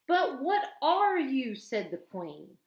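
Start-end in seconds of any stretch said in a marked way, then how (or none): 0.1-1.5 drawn-out, imperious and louder; slow queenly demand. A narrator's reading. Queen pressing Edmund again, slow and imperious. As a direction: press the question slowly.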